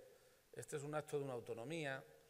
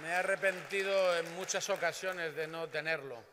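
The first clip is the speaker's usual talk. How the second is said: louder, higher, ring it out to the hall; loud rousing confession; 5 semitones higher, 9 dB louder than usual. Real, not acted. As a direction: confess it proudly to the crowd. (confesión en voz alta y enfática)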